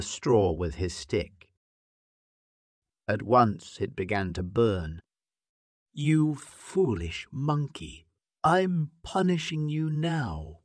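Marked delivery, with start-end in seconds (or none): 5.9-10.6 slow, higher scornful lion; lion crying out. Acted. Lion scorns the monkey before the fire, slow and mild. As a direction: sneer down at the foolish monkey, slowly. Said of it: "cried the lion".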